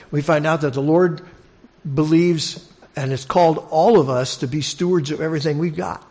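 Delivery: ordinary delivery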